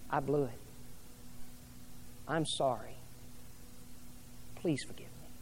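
a soft, humbled apology fading out; hushed apology. Acted. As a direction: confess it quietly, almost breathless. Pastor voices an apology to mate.

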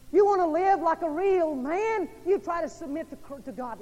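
high, loud preacher's cry; fiery pulpit call; preaching at full force. Acted. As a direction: preach it out to the whole church.

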